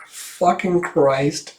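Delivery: breaking up into breathless laughter; slow curse squeezed through real laughing; laughing at slip. Real, not acted. Reader cracks up fumbling a name, laughing.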